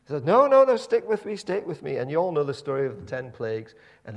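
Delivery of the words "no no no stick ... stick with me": high, loud urgent reassurance; Moses pleading. Retold or imitated. Moses urging the complaining Israelites, high and loud. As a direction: beg them to trust you, raised.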